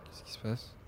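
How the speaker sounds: breathy puzzled whisper; whispered question. Real, not acted. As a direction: ask it under your breath. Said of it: "repeated question". (question chuchotée)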